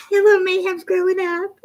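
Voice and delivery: high-pitched, talking through giggles; laughing. Real, not acted. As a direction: squeeze the words out through real laughter. Giggling at a viewer's comment.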